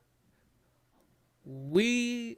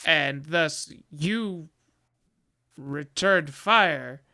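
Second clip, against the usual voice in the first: normal talking voice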